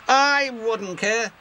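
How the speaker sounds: haughty interruption. Acted. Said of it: "interrupted James".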